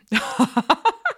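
high, loud cackling burst; gloating laugh. Acted. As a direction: laugh loudly over their struggle. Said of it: "I laugh as you scream and".

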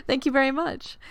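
high laughing thanks; thanking through giggles. Real, not acted. Laughing at compliment.

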